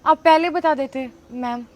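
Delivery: sheepish backing down. Acted. Girl apologizes to SDM officer mother, quiet and sheepish. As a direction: deflate and mumble your excuse. Girl backs down after learning truth.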